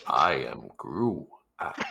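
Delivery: very low, drawn-out giant voice; Gruak. Acted. Giant introduces himself to the woman, deep slow voice. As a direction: announce yourself slowly, far down in pitch.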